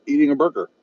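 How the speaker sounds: ordinary talk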